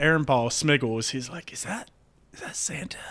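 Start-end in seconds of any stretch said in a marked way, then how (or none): 1.5-3.0 soft, higher, half-whispered question; tentative hushed elf; hushed wondering. Acted. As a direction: ask it under your breath, unsure. Elf cautiously spots Santa at night.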